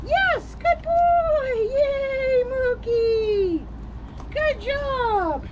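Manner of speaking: high, squealing dog praise; talking to a dog. Real, not acted. Praising dog after success.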